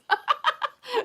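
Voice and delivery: high, rising laugh; high giggle. Real, not acted. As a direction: giggle up at the thought. Laughing at campers idea.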